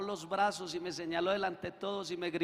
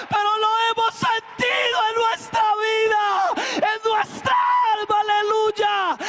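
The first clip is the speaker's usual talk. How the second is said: high, loud, slow shouted praise; preaching at full force; 13 semitones higher, 9 dB louder, slower than usual. Acted. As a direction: lift the church with a shouted alleluia. (predicación a todo pulmón, gritando)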